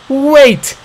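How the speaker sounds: very high loud plea; desperate shout. A narrator's reading. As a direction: beg him to stop. Calling after father.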